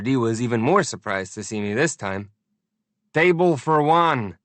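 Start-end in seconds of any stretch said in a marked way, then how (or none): 3.1-4.4 shouting. A narrator's reading. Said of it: "the K G B man shouted".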